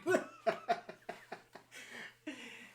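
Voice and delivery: long high-pitched laugh. Real, not acted. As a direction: let the laugh ring out.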